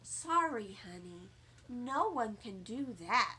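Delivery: put-on calm a child down; soft, patient mother; gentle mother.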